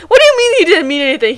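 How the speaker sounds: high, loud protest; outraged at the story; disbelieving retort; indignant. Real, not acted. Indignant at the text.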